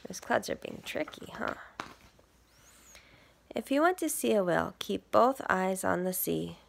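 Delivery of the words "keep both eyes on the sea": usual speaking voice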